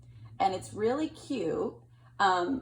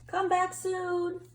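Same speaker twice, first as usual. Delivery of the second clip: slow, higher sing-song goodbye; friendly farewell; 10 semitones higher, much slower than usual. Acted. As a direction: call it after him fondly. Unicorns saying goodbye.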